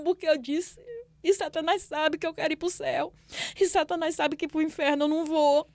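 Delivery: high, loud, impassioned plea to God; desperate crying-out prayer. Retold or imitated. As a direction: cry out to God as if reliving it. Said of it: "falando com Deus desse jeito". (clamor desesperado, alto e agudo)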